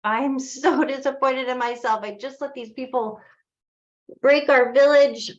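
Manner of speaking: high, hammed-up tearful wail; mock crying. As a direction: wail in self-reproach, rising to a loud finish. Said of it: "crying and saying".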